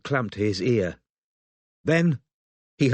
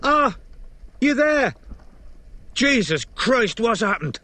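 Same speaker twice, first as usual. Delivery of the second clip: frantic shouting; 12 semitones higher, slower than usual. A narrator's reading. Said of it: "he modulated his volume".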